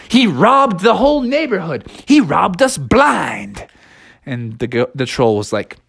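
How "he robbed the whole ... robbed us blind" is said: high-pitched mock outrage; pitched-up dramatic complaint; indignant. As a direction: accuse with hammed-up indignation. Bears accuse the goat.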